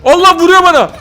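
high, loud cries for help; mock panic. Player being hit and begging for help, mock panic. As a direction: yell as if under attack.

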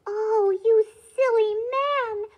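loud, high, drawn-out exasperation; scolding cry. A narrator's reading. Wife berates her husband over the wasted wish, crying out. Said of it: "cried the woodcutter's wife".

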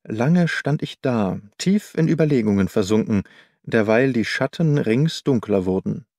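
plain reading voice

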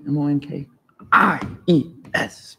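M O N K I E S spelled letter by letter. Shown as a demonstration. Teacher spelling monkeys to the class letter by letter. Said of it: "spelling out monkeys".

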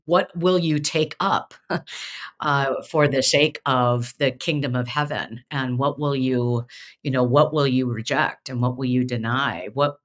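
normal talking voice

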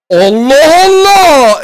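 high, loud, long-drawn exclamation; astonished yell. Real, not acted. Gamer baffled by a loan card, astonished yell. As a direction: stretch it out in loud amazement.

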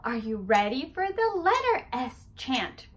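ordinary delivery

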